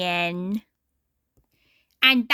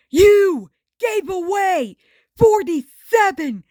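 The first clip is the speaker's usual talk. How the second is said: shocked Ren voice, much higher and louder; hollered; 8 semitones higher, 5 dB louder than usual. A narrator's reading. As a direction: yell it out in disbelief. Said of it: "he hollered".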